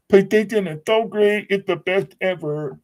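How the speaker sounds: sarcastic fake enthusiasm; mock fanboy praise. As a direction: ham up a fanboy's worship. Mocking PlayStation fans.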